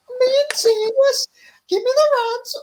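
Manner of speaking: very high, loud Scouse impression; Darren Till. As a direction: squeal it in a struggling mock-Scouse accent. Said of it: "try and do a Scouse accent".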